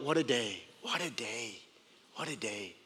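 tender hushed fade; fading to a hush. Acted. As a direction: let each what a day die away into a near whisper.

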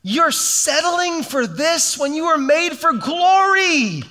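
high, incredulous pleading; God stunned by what we settle for; God exasperated. Retold or imitated. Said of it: "It's like".

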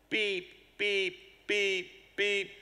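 high beeps mimicking a car alarm; imitated car horn. Imitating the alarm drowning out his sermon, high beeps. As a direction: honk like a stuck car horn. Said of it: "a horn starts blaring".

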